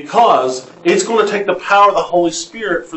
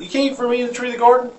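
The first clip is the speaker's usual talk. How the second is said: higher, fast, sly twist of the words; mocking serpent voice; 8 semitones higher, 3 dB quieter, much faster than usual. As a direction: sneer it like the lying serpent. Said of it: "But he says that".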